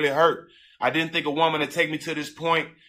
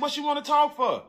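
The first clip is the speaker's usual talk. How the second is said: much higher, incredulous question; indignant retort; 10 semitones higher than usual. Retold or imitated. Groom answering her messenger, indignant. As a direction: push back in a high, put-out voice.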